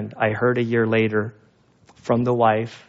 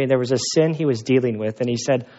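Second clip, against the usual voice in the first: usual speaking voice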